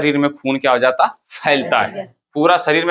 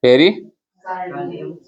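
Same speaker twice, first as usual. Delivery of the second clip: "Pericardium" बहुत धीरे, तोड़कर; "पेरि" के बाद लंबा ठहराव; शब्द दोहरवाने का लहजा; much slower than usual. Shown as a demonstration. (कक्षा को "पेरिकार्डियम" टुकड़ों में रटवाएँ)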